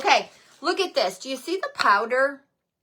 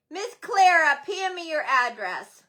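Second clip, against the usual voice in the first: ordinary delivery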